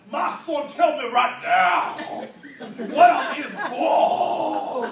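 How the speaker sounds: loud, slow booming demand, last words stretched long; commanding pastor. Acted. Pastor standing over the frightened boy, booming demand. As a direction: thunder it at the boy, drawing out each word. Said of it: "looks down on the young man and says".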